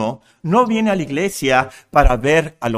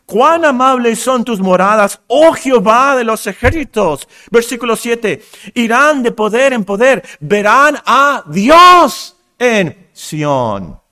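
loud, ringing pulpit reading; high, forceful Bible declamation; declaimed scripture; 7 semitones higher, 8 dB louder than usual. Real, not acted. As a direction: proclaim the psalm, building to a long, loud "Dios". Declaims psalm at full force.

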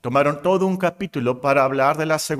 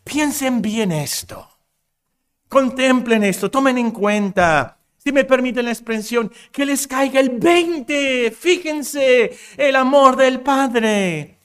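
higher, slow, emphatic plea; the apostle urging them to grasp it, slow and high; fervent urging; 7 semitones higher, slower than usual. Pretend. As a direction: press it home like an apostle. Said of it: "nos dice aquí".

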